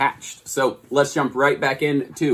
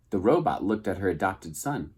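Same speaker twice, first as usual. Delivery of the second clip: usual speaking voice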